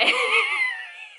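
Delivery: very high shrieking laugh; squealing laugh. Real, not acted.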